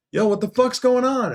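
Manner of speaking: high, loud alarmed call across the floor; manager calling out. Retold or imitated. Manager shouting across the shop, alarmed.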